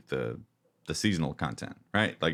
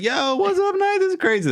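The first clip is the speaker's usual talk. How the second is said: squeaky excited dude greeting a knight; goofy high voice; 5 dB louder than usual. Pretend. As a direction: pitch way up like a hyped cartoon guy.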